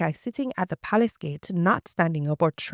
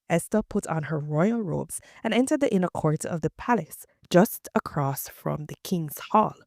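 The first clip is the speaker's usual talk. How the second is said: ordinary delivery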